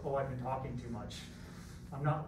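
ordinary talk

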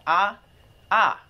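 "Ah" held high and steady, a level, sustained tone. Shown as a demonstration. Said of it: "it just means a high tone".

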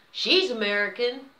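imitated loud public announcement; loud declaration. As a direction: call it out loudly, as if exposing someone to the room. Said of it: "she then declared very loudly".

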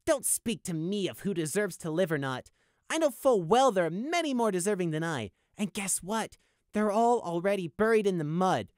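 high, detached icy voice; cold. Acted. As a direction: answer with cold contempt. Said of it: "Prey responded coldly".

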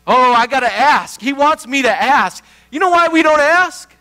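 loud, high preaching, slowing on "You shall ask"; full-force preaching. Acted. Pastor to congregation on God's promise, preaching full force.

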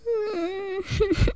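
high laugh breaking into tears; teary laugh. Acted. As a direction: laugh while the tears come.

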